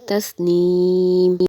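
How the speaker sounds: تسنیم said with the ending dropped, long ee and a silent final m; pause form tasneem. Shown as a demonstration. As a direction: stop on تسنیم with the stretched ee and a clean silent m. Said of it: "وقف میں پڑھیں گے". (تسنیم کو وقف کے ساتھ ساکن پڑھیں)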